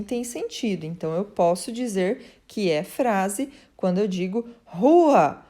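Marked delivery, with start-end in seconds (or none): 4.6-5.4 loud, higher cry of "rua" meaning get out; shouted order. Shown as a demonstration. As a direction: fling "rua" out as a command to leave. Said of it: "No sentido de que vá embora".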